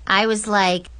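plain reading voice

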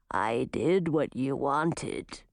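low, slow monster voice; menacing manticore. A narrator's reading. Manticore tells the girl he obeyed, low menace. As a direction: toy with your prey, low and unhurried.

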